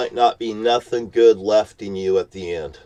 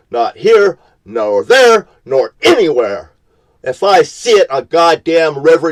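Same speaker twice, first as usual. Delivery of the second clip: angry shout; 6 semitones higher, 8 dB louder than usual. Real, not acted. Ranting about garbage in streets.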